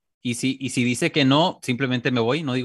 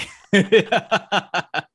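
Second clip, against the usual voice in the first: hearty laugh; 6 semitones higher, 6 dB louder than usual. Real, not acted. Laughs after the bit.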